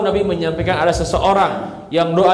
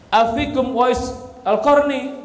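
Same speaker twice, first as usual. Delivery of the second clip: slow, higher-pitched call to a crowd; lightly acted Umar, calling out a slow question; 6 semitones higher, slower than usual. Umar calls out to Yemeni pilgrims.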